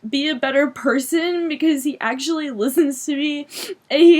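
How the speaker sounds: high, tearful and halting; choking out how much he means through tears; still crying. Real, not acted. Girl still tearful about boyfriend who listens to her, high and halting. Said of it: "I just have to cry".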